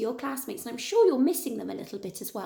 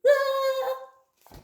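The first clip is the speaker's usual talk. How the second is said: long, very high "aah"; falling scream; 13 semitones higher than usual. A narrator's reading. Tyrannosaurs washed down to the sea, high scream. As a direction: cry out as if swept away.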